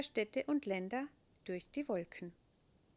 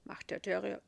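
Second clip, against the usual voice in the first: plain reading voice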